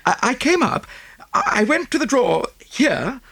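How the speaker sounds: high, breathless socialite voice; gushing rich American lady. Acted. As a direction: pour it out like a fussy rich lady. Mrs Oppleson voiced.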